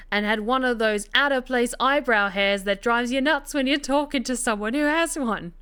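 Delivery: hammed-up fake Cockney storyteller; bad Cockney. Narrator telling Rita's forest detour on request, in bad Cockney. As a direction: spin the tale in a badly faked Cockney accent. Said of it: "To, to do the bad Cockney accent".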